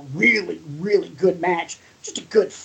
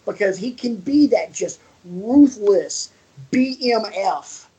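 usual speaking voice

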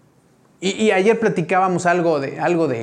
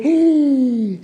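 sudden higher exclamation of dismay; startled gasp; 6 semitones higher than usual. Retold or imitated. Preacher retelling seeing his open zipper in the mirror, startled. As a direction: realize the embarrassment all at once.

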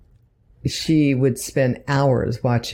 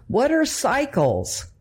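put-on puzzled little girl; 10 semitones higher than usual. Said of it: "she's like".

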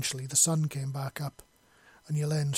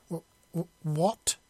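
stammering a mild, shocked what; stunned exclamation; 2 semitones higher, slower than usual. Acted. Woman stunned by his cold accusation, stammered exclamation. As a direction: trip over the start, then blurt it out. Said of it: "she exclaimed".

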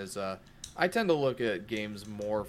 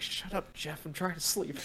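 annoyed sleeper; 6 semitones higher, 4 dB quieter than usual. Pretend. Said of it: "This guy's like".

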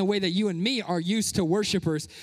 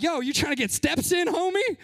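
higher, playful ribbing with homie climbing high; teasing joke; 9 semitones higher than usual. Retold or imitated. Pastor joking at people running round church, playful teasing. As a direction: tease the runner like a buddy. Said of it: "I'm like".

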